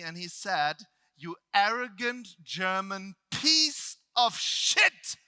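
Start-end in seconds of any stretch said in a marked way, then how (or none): 1.2-5.2 loud, slow, drawn-out insult; angry yell. Retold or imitated. Trainer to speaker after a long silent minute, angry yell. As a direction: spit it out loud, word by word. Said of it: "He yells at me".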